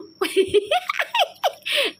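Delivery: a high squealing giggle; high-pitched burst of giggles. Real, not acted. Laughing at a viewer's joke.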